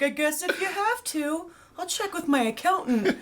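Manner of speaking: high and slow, timidly giving in, laughing; a high, slow, hesitant mock of a naive star. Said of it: "He's like".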